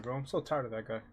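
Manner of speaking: usual speaking voice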